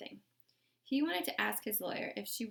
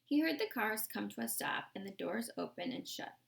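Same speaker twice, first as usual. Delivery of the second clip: ordinary delivery, nothing marked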